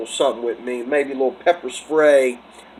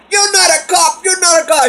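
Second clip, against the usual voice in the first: high, loud shouting woman; mock shrill lady; 5 dB louder than usual. Lady shouts at the man making an arrest, high mock shriek. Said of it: "She said".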